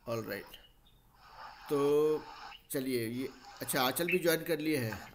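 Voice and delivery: ordinary talk, nothing marked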